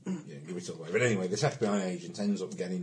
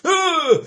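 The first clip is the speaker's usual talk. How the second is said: sudden high yelp; mock startled shout; retold yelp; 16 dB louder than usual. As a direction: jolt out a startled cry. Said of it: "the guy goes".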